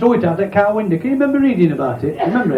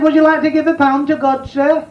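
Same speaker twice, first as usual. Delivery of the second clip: mock polite caller; 6 semitones higher than usual. Jehovah's Witness voiced in joke.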